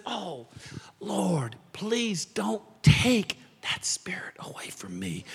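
whispered plea, voiceless and slow; hushed prayer. Retold or imitated. David whispering his plea to God, hushed and slow.

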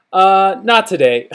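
hesitant, drawn-out brush-off with a falling end; awkward, slow refusal; sketchy excuse. Retold or imitated. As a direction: stall on "Uh", then let "not today" drop. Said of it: "such a sketchy little answer".

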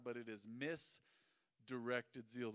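slow deliberate sermon punchline; slow emphatic. Shown as a demonstration. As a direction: lay out misdirected zeal slowly for the crowd to take in. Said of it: "The word misdirected means".

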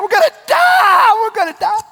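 very high screamed freak-out; hammed-up terrified shriek; mock panic. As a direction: scream it like drowning men. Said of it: "they're freaking out".

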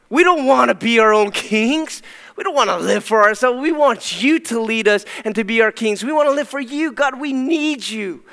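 high, loud hammed-up zealous plea; mock zealous crying out. Preacher mocking a congregation crying out to God, high and loud. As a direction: ham up an over-eager worshipper. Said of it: "we cry out to God and say".

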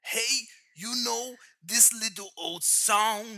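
slow, drawling singer-songwriter impression. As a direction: stretch it out like an old crooner. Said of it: "We've got [name]".

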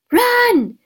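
high, drawn-out urgent yell. Acted. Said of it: "Harry yelled".

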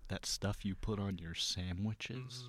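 slow, hushed, low impression; George Washington Carver. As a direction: draw it out softly and deeply. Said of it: "Give me your best George Washington Carver".

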